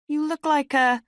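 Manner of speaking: high-pitched, solicitous woman's voice; concerned older woman. A narrator's reading. Middle-aged woman asking a bedraggled stranger, concerned. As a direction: check on her in a high, motherly voice.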